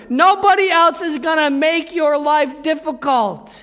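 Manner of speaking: high and loud, hammering each "Nobody"; fervent preaching. Real, not acted. Preacher telling the congregation about brotherly love, fervent. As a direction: preach it with conviction. Preacher driving the point home.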